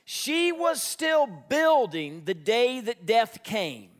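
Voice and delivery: ordinary delivery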